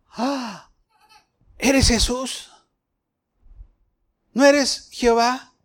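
high, amazed questions; stunned disbelief. Acted. Saul realizing who speaks to him, high astonished questions. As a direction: Saul caught off guard, voice up.

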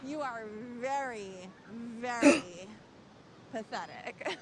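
sarcastic. Acted. Teasing someone's poor fitness.

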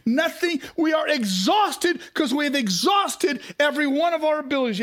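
high, loud, pleading shout; exasperated outcry. Retold or imitated. Peter protesting to Jesus, loud and exasperated. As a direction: throw up your hands in defeat. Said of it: "He says".

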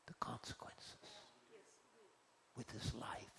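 grave hushed close of the story. Real, not acted. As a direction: let it fall to a whisper. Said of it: "preacher drops voice for ending".

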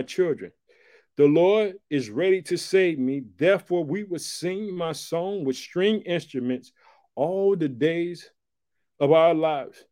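loud, slow, ringing pulpit reading; preaching at full force. Acted. Preacher declares Hezekiah's words to the church, loud and preached. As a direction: proclaim the lament to the back row.

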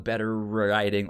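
usual speaking voice

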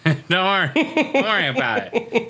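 brushing it off, laughing; laughing dad quote. Retold or imitated. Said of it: "'Cause he doesn't wanna think about it".

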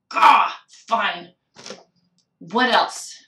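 a loud, exasperated "Gah" and then a grudging question; frustrated growl. Retold or imitated. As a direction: vent the frustration, still not done. Frustrated inner voice, beating the post.

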